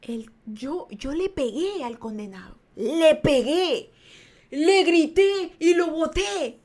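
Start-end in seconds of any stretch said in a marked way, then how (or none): 2.8-6.6 slow, loud, high drawn-out words; emphatic disbelief. Retold or imitated. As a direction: hammer out each deed, astonished. Stunned recap of own feat. (enumeración lenta y enfática)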